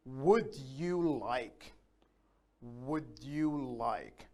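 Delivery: very slow, drawn-out teaching delivery; teacher slowly modelling a phrase; slow model phrase. Shown as a demonstration. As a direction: stretch each word out for learners to follow.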